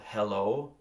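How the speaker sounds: "hello" with the weight on the O; stress on second syllable. Shown as a demonstration. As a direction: lean on the final O of "hello". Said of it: "the O is, is the accent".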